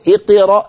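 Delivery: اقرأ said high with ق leaned to qi; mock wrong reading. Teacher imitates a student misreading before a reciter. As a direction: raise اقرأ, ق thin. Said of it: "ولو قلت أمام أي قارئ".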